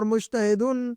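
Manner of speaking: مجتہدن said slowly with a sounded -un ending; tanween ending. Shown as a demonstration. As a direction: hold the final "-dun" of مجتہدن. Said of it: "یہ تنوین جو لگا رہے ہیں".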